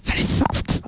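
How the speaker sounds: breathy swooning whisper. Real, not acted.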